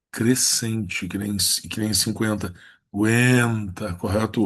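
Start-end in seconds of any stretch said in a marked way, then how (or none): 2.9-3.9 Aguenta slow, with a long stressed E; stronger E. Shown as a demonstration. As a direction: stretch the E in "Aguenta". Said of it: "O E é mais forte".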